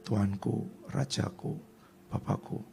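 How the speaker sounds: soft, almost whispered humility; David softly honoring his king; humble soft address. Retold or imitated. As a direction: plead gently, lowering yourself.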